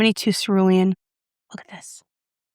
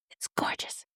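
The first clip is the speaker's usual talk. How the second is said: unvoiced, breathy whisper; hushed in admiration; awed whisper; 15 dB quieter than usual. Real, not acted. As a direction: whisper it like a secret. Awed over compact.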